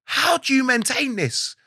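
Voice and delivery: mock-amazed, high and slow; imitating teammates in awe, drawn out; amazed question. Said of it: "the lads are going".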